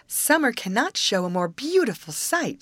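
shining-eyed delight. A narrator's reading. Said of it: "with her eyes shining".

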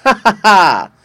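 high loud tinkling laugh; a mischievous sprite cackling with glee; impish laugh. A narrator's reading. Jack Frost laughs again.

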